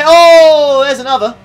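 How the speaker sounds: sudden loud startled oh; jolted by a crash, blurting it out; shocked oh. Real, not acted. Streamer startled by a crash, loud oh.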